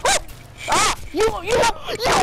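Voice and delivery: high, loud startled yell; surprised outcry. Real, not acted. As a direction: yelp at being knocked out. Knocked out in game.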